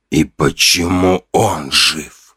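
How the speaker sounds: low, slow, chilling question; icy cold. A narrator's reading. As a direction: freeze the listener with it. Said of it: "с таким холодом в голосе".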